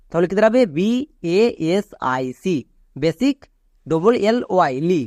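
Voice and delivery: ordinary talk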